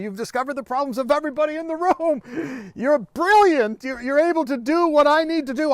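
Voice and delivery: loud, high, insistent acted outburst; building to a shout.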